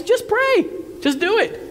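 high-pitched, exasperated exhortation; emphatic urging. Retold or imitated. Preacher voices James to the suffering, emphatic high urging. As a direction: urge it up high, as if it were obvious.

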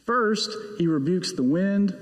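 usual speaking voice

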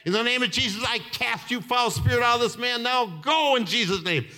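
fed-up commanding prayer, acted out; angry rebuke. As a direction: cast it out firmly, retelling your fed-up moment. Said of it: "I got angry".

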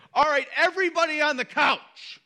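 high, carrying summons to the whole house; dad's emphatic call. Retold or imitated. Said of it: "it goes a little something like this".

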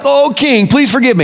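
high-pitched imitated plea; mock begging. Preacher mimicking the servant before the king, mock begging. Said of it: "begged the king forgiveness".

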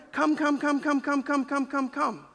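high, insistent repeated calling; clingy begging. Acted. Preacher mimics a desperate friend pleading for company. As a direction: beg them over and over to come.

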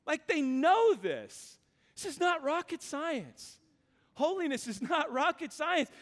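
high-pitched, incredulous insistence; worked-up disbelief, pitched well up; exasperated. Acted. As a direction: protest that it should be obvious. Preacher voices exasperation at rebellious people.

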